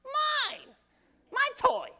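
put-on very high, loud toddler whine; child's mine. Small kids shouting their first word about a toy, childish. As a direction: squeal it like a small kid grabbing a toy. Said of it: "first word they say".

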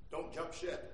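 low, soft plea; hushed counsel. Real, not acted. Preacher to someone who just lost their job, hushed counsel. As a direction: urge it quietly from the pulpit.

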